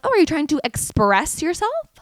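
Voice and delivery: high-pitched sarcastic question; mock questioning. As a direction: needle them with a high, mocking question. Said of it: "I'm like".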